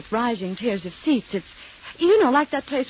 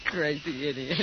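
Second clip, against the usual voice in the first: laughing; 6 semitones lower, 4 dB quieter than usual. Acted. Laughing at friend's joke, affectionate tease. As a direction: laugh then low fond jab.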